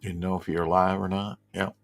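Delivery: ordinary talk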